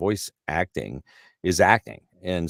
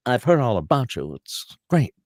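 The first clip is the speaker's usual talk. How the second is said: higher-pitched, animated retold greeting; Bill Shatner; 7 semitones higher, 4 dB louder than usual. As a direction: voice an enthusiastic star meeting a fan. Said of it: "so we go back, and he goes".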